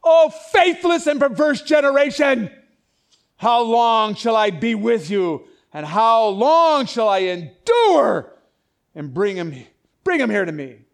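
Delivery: loud, slow, furious rebuke of the faithless; angry shouting Jesus. Shown as a demonstration. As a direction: thunder it out as an enraged preacher. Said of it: "did you hear it this way?".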